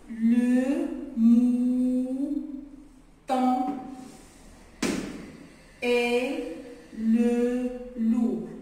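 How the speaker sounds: "Le mouton et le loup" très lentement, plus fort; répète en détachant chaque mot; lecture modèle lente. Shown as a demonstration. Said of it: "Je répète". (lecture appuyée pour enfants)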